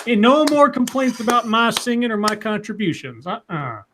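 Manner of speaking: sass it like a Southern lady; a hint of a Southern accent. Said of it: "went all Southern on that part".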